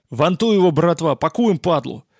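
loud, high, rough skipper yell; blurted rally cry. A narrator's reading. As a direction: blurt it out with gusto. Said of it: "Выпалив это". (азартный выкрик)

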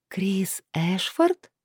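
slow, questioning read of a name. A narrator's reading. Lilith reading the team list aloud, slowly questioning. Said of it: "прочитала Лилит".

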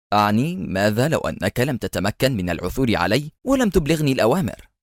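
plain reading voice

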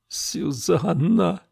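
very high, very slow moan. A narrator's reading. Camilla moaning her dead friend's name. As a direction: draw the name out in grief. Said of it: "простонала Камилла".

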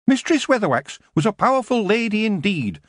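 high dwarf voice, choosing each word with care; carefully. A narrator's reading. Dwarf Shrocker carefully praising the old witch. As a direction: tread lightly around a dangerous name. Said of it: "Shrocker said carefully".

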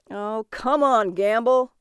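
loud, drawn-out heckle; yelling out. A narrator's reading. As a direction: call it out loudly across the room. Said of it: "Thompson yelled out".

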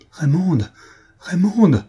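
a desperate cry over her body; anguished cry. A narrator's reading. Lupin cries over fallen Raymonde. (Lupin crie le nom de Raymonde, désespéré)

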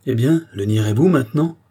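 crying out. A narrator's reading. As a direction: exclaim it as an accusing demand. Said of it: "s'écrie Marie-Antoinette".

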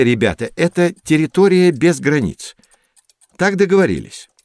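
ordinary delivery

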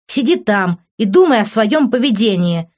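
heated, insulting rebuke; angry scolding. A narrator's reading. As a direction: scold him with indignation. Angry scolding of drunken boyfriend.

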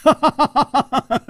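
high, staccato ha-ha laugh; bright burst of rapid laughter; merry laugh. A narrator's reading. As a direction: laugh long and showily. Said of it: "躬身笑道".

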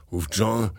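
ordinary delivery

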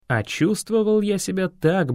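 ordinary delivery, nothing marked

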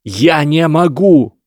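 high, loud and slow; desperate shout. A narrator's reading. Harry shouts back at Lesath just as desperately. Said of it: "Гарри кричал так же отчаянно".

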